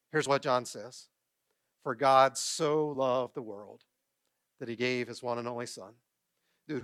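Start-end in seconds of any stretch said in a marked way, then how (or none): 1.8-6.8 scripture recitation. Acted. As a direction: recite it solemnly, phrase by phrase. Recites a Bible verse to congregation.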